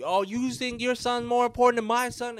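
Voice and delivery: high, heated retold yell; mock shouting back in the argument. Family yelling back at the mom over sons, a mock heated yell. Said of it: "now we just yelling".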